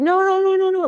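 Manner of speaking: very high, rapid-fire mock child's no; retold upset refusal. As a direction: imitate a crying kid refusing outright. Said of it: "I was, like, crying. I was upset".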